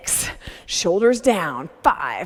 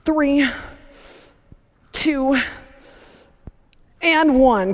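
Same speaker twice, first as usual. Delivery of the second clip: straining while rowing; 7 semitones higher, much slower than usual. Real, not acted. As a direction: count through the strain. Rowing her low-angle set.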